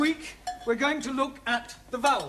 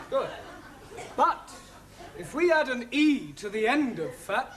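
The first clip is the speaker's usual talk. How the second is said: normal talking voice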